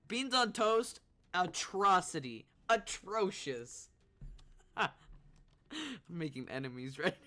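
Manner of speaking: usual speaking voice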